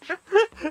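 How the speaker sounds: 1 high burst of laughter. Real, not acted. Player laughs at scene.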